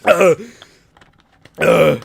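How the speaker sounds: loud hiccup bursts. Real, not acted. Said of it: "Did that scare the hiccups away?".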